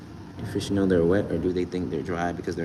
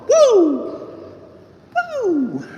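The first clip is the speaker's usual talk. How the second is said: excited whoop; 6 dB louder than usual. Real, not acted. Hyped sign-off to viewer.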